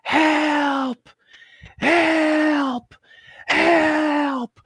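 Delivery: high, shrieking mock cry for help; peacock screaming help. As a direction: mimic a peacock shrieking like a woman. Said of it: "sounds like a woman screaming".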